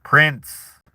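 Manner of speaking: normal talking voice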